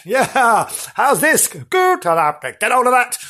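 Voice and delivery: high-pitched, goading mock attack; taunting shadow spook. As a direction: taunt like a pantomime ghost.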